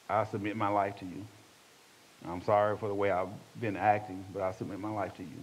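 low, soft, humble confession; prayer of surrender. Retold or imitated. Man apologising to the Lord while driving, low humble prayer.

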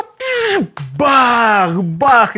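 loud, high pf then booming bah, bah; explosion noises. Retold or imitated. Imitating shells hitting the building, loud booms. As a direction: blast out the shell hits.

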